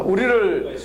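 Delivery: ordinary delivery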